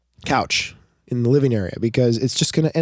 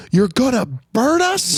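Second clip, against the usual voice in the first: put-on high, far-off little child voice; a tiny kid aghast at his dad; horrified little kid; 10 semitones higher than usual. Dad imitating his horrified three-year-old.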